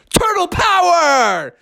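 high, drawn-out heroic cry; shouted battle cry. A narrator's reading. Four turtles announce themselves in the sewer, shouting out. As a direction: shout it like a team slogan. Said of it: "four voices shouted out".

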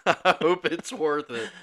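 giggly mock-hopeful line, pushed up and stretched; amused repeat. Repeats own quote, bigger, laughing.

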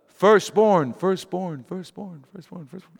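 firstborn repeated, fading to nothing; echoing word. Acted. Laban's excuse echoing, fading away. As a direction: let firstborn echo and die away.

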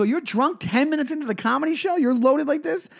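high-pitched incredulous scolding; retold outraged questioning, rising at the ends; retold screaming. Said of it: "I just screamed at this dude".

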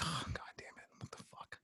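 whispered curse, no voice at all; muttered whisper. Retold or imitated. Seeing the car parked out front, whispered swearing. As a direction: hiss the curse under your breath. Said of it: "And I'm like".

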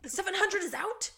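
a high, incredulous gasp of a question; shocked disbelief. Acted. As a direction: act stunned that it's gone. Acting out the character's shock.

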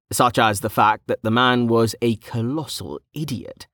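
ordinary delivery

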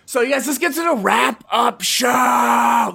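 announcer shout. Acted. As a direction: call it out like a host. Announcing the wrap-up segment.

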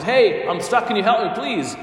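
acted plea from a trapped man; pleading for help. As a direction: call up and beg a passer-by. Trapped man calls to passer-by.

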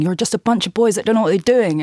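exasperated quoted telling-off, sped up; retold shout. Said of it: "basically just shouts at them".

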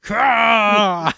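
loud, high battle cry; villain attack cry. Acted. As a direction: shout it like a cartoon villain unleashing power.

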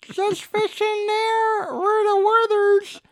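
very high, slow put-on voice; creepy parody grandpa. Grandpa telling grandson to fish in pocket, high slow parody voice. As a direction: stretch it out in a loud, squeaky-high parody.

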